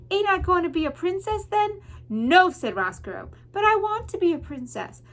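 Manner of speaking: put-on high, pleading girl voice; whiny servant girl. Mig pleading with Roscoe, high childlike whine.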